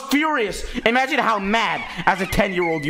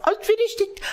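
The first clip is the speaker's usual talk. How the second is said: high mock voice; 12 semitones higher than usual. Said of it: "I didn't say that, but".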